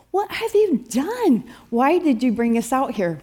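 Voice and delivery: high-pitched accusing outburst, easing toward the end; indignant complaining. Acted. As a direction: mimic an outraged crowd blaming its leader. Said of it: "they were complaining".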